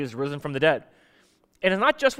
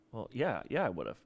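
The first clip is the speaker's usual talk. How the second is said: much lower, softer, hanging-head yes; sheepish admission; 7 semitones lower, 12 dB quieter than usual. Retold or imitated. Peter admitting to Jesus, low and sheepish. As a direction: admit it quietly, ashamed.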